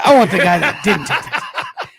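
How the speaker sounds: repeating it through laughter; laughing. Real, not acted. Cohost echoing his partner's joke, laughing. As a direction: laugh while echoing the line.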